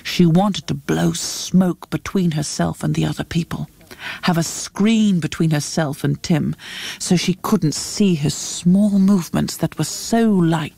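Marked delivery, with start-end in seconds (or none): none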